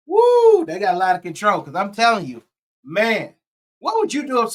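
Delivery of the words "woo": sudden high, loud woo; excited whoop. Real, not acted. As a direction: let out a real burst of amazement. Reaction to spitting in clip.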